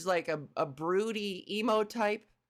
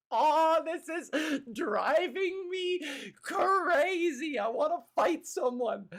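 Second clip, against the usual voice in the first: high, hammed-up frustrated shout; exasperated outburst; 7 semitones higher, slower than usual. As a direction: wail it like you're being driven crazy. Acting out exasperated player's outburst.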